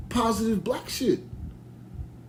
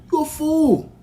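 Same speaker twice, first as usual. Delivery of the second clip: higher, sharper put-down; firm rebuke; 8 semitones higher, 4 dB louder, slower than usual. Real, not acted. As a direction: call him a fool with force. Scolding the critics.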